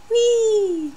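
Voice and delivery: high playful squeal; silly whee. Real, not acted. Silly exclamation showing dragonflies.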